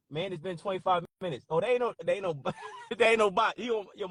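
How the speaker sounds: ordinary talk, nothing marked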